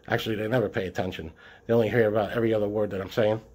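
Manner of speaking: rapid, rushed delivery; very fast talking. Real, not acted. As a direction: rattle the words off quickly. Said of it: "Why do you talk fast?".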